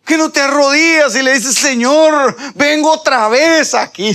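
high, loud, driving exhortation; full-force preaching. Real, not acted. Preacher urging believers to keep praying, full-force preaching.

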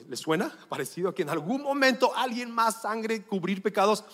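usual speaking voice

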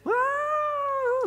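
a sudden high wailing cry; imitated wail. Preacher imitating sisters wailing at communion, high wail. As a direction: let out a short imitated wail like a weeping sister. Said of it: "literally wailing".

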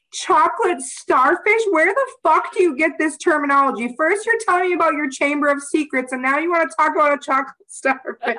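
imitating mom, high and incredulous; shocked mom. Mom to her daughter over the crude term: incredulous, high, laughing. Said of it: "fucking lost her mind".

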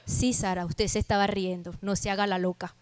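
low, soft, unimpressed scolding; knowing reproof. Acted. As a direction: quietly call out the fib. God calmly catches Sara out.

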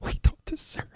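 soft, voiceless tearful whisper; real breakdown, barely getting words out. Real, not acted. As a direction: whimper it in broken pieces. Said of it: "you're getting me to cry".

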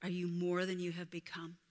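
usual speaking voice

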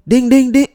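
high, loud ding-ding chime mimic; imitating doorbell. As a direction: ring out like someone leaning on the bell. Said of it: "he rang the doorbell like he lives here".

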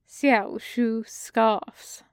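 usual speaking voice

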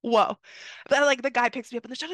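ordinary talk

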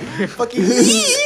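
slow, drawn-out gleeful yee; excited yell imitation. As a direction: mimic the friend's happy cry. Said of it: "Just fucking gets excited".

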